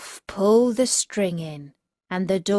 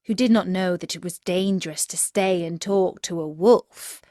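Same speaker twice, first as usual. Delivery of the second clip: plain reading voice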